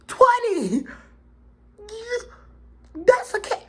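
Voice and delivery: very high, loud outraged disbelief; appalled shriek. Retold or imitated. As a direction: shriek it like you can't believe it. Appalled he is only twenty.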